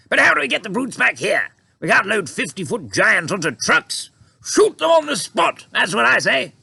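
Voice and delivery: high, loud officer bluster; blustering general. A narrator's reading. General wanting to shoot giants, loud high bluster. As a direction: shout it like a hawkish general. Army head objects.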